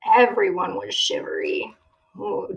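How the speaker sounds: usual speaking voice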